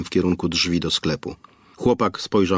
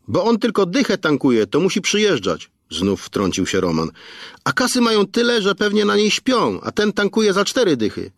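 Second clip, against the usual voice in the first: indignant gossip; 12 semitones higher than usual. A narrator's reading. Roman interrupts again to mock the rich kid, high and scornful. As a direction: scoff at the cheap rich kid.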